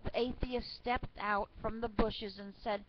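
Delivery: ordinary delivery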